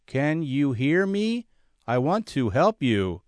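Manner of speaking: patronizing stranger speaking very slowly. Retold or imitated. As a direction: talk slowly, like to someone you think can't understand. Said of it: "he said very slowly".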